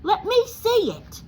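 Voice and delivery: high, loud demand; a spoiled boy's order. Acted. Dudley to his father, a loud high demand. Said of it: "demanded Dudley".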